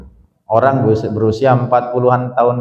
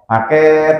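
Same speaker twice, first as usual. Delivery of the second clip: higher, drawn-out delivery call; courier calling out; 5 semitones higher, 3 dB louder than usual. Acted. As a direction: break in like a courier at the door. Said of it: "ada suara-suara".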